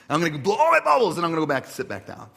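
loud, high, fast mock boast; competitive outburst. Pastor mocking his urge to win bubbles, loud frantic boasting. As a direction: burst out with hammed-up competitive zeal. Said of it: "like, this competition".

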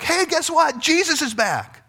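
high, gleeful mock news-breaking; excited announcement. Mary telling her friends Jesus is back, high mock excitement. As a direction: call it out high, stretching the last words.

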